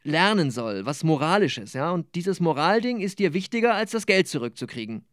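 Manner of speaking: lebendige Romanlesung mit gespielten Figuren; Lesung. Acted. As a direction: lies die Szene wie bei einer Lesung vor Publikum. Autor liest aus seinem Roman. (Autor liest vor Publikum aus seinem Roman, lebhaft vorgetragen)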